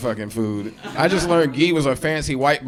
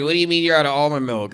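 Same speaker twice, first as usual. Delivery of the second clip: entitled customer; 2 semitones higher, faster than usual. Retold or imitated. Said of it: "they're always just like".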